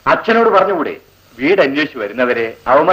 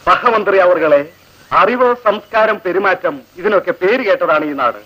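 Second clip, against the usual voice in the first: high, sneering mock official; mock minister; 6 semitones higher than usual. Seniors ragging juniors, high sneering mock authority. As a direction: lord it over them with a sneer. Mocking ragging of new girls.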